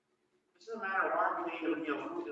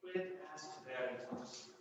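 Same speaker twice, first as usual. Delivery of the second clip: "Quid est veritas?" repeated much softer and slower, almost to himself; quiet repeat; 5 semitones lower, 11 dB quieter, slower than usual. Shown as a demonstration. Preacher echoing Pilate's Latin question, hushed and slow. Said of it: "In his Latin, he would have said".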